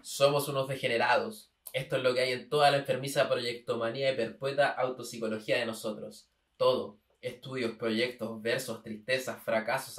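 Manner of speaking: emphatic, even-pitched literary recitation; declaimed reading. Acted. Citing the writer's own words to the co-host, declaimed reading. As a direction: read it out loud and firm, item by item. Reads a writer's text aloud.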